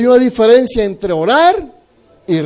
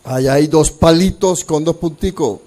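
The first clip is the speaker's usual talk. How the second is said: ordinary talk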